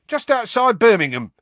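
high, loud, cheerful announcement; happy. A narrator's reading. Stan answering Harry's unasked question, happily. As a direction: call out the location happily. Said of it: "said Stan happily".

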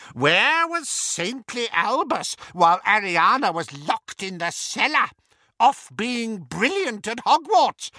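high, loud, gossipy jab; needling old aunt. A narrator's reading. Aunt mocking Albus to the guests, high and loud. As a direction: lay into him like a spiteful old witch. Muriel's accusing rant.

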